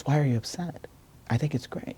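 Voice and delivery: quoted teacher in a lifted, softened voice; puzzled teacher. Retold or imitated. Teacher's puzzled reply acted out.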